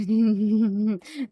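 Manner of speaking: loud laugh breaking into speech; real, loud laugh; burst of laughter. Real, not acted. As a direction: crack up loudly mid-sentence. Overcome with excitement mid-sentence.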